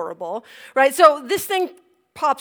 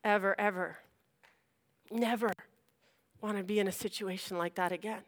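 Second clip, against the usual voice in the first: weary vow; 5 semitones lower, 9 dB quieter than usual. Retold or imitated. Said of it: "I am just like".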